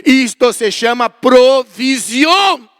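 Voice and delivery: loud, high, drawn-out preacher's cry; slow it down and build to a shout; triumphant proclamation. Retold or imitated. Shopkeeper's words retold to congregation, proclaimed triumphantly. Climax of retold gift of food.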